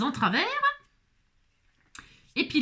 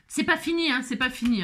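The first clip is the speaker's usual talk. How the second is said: ordinary talk, nothing marked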